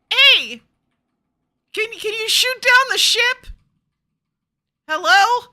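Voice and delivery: loud high shout across the room; calling out. Acted. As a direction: yell up at the machine for an answer. Calling out to the machine.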